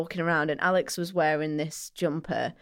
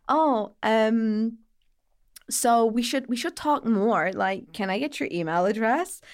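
American stranger; 4 semitones higher, 3 dB louder than usual. Retold or imitated. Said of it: "then he goes to Alex".

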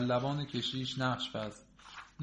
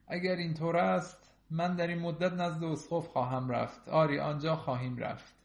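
usual speaking voice

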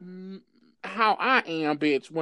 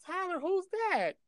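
higher, lightly voiced sweet mother; a hint of a nosy mom calling out; curious mama; 9 semitones higher, slower than usual. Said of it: "his mom gonna say something".

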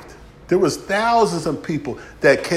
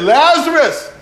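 high, loud summons of Lazarus; Jesus crying out to dead Lazarus; calling out loud; 12 semitones higher, 8 dB louder, slower than usual. Acted. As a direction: call him out of the tomb.

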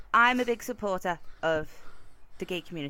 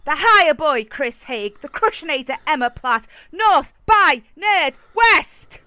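booming wrestling announcer intro; loud, drawn-out ring announcer; ringmaster; 4 semitones higher, 11 dB louder than usual. Acted. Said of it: "That was a wrestling reference".

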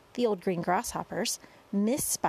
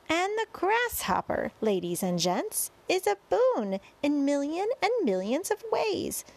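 lively showman's rhyme with high peaks; sing-song verse introduction; 6 semitones higher than usual. A narrator's reading. Boy presenting grasshopper to crowd, sing-song verse. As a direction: sell each act in bouncy verse.

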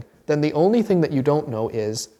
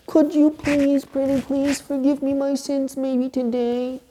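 high, slow, meek begging; timid pleading; 11 semitones higher than usual. Shown as a demonstration. Sinner asking God timidly for forgiveness: high, meek plea. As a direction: plead shyly as if you might be refused. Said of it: "instead of".

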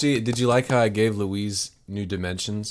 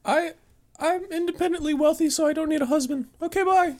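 very high falsetto brush-off; prim put-on high woman's voice; Louise. Louise turns Todd down and leaves, high falsetto. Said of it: "get the character of Louise".

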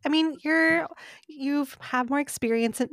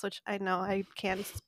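ordinary talk, nothing marked

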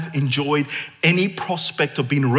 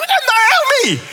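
much higher, loud joyful cry; delighted recognition; 6 dB louder than usual. Retold or imitated. Worshipper recognizing Naomi in heaven, joyful cry. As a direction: call her name in glad surprise.

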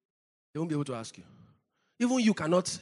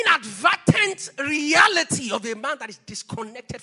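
sudden loud, higher emphasis; forceful preaching; 7 semitones higher, 9 dB louder than usual. Real, not acted. Preacher warning about Saul cut off from priesthood, suddenly loud. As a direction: hammer the phrase out.

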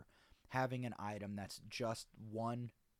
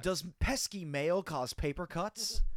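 infomercial; 7 semitones higher, 8 dB louder than usual. Pretend. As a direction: pitch a silly problem dramatically. Parody infomercial about mail.

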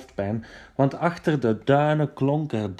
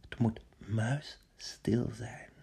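soft, slow whisper; 8 dB quieter, slower than usual. Acted. Odysseus whispers up to his soldiers, slow whisper. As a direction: whisper so nobody hears. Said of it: "Hij fluisterde naar boven".

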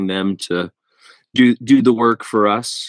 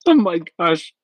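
shocked laughing protest; 10 semitones higher than usual. Real, not acted. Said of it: "Who did I poop my pants with?".

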